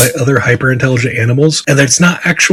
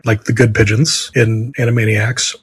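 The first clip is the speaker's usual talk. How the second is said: ordinary delivery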